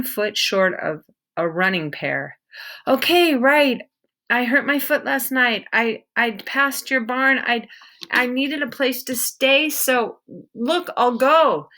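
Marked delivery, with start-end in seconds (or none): 2.9-11.7 a lightly voiced boy, higher and hurried; a hint of a frightened kid backing off; nervous boy. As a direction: stammer an excuse to a scary stranger. Peter explains nervously.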